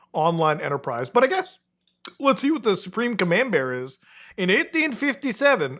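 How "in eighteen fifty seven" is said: high, hammed-up Soviet-accented narrator. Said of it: "giving it, like, a Soviet accent".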